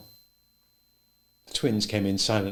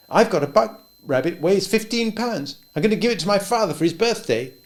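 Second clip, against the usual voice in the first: put-on higher, eager young boy voice; a shy boy showing off his rabbits; 11 semitones higher, 7 dB louder than usual. A narrator's reading. Aaron bragging about rabbit.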